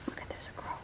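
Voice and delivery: hushed aside; whisper. Real, not acted. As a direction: whisper it softly. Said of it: "whispered picture aside".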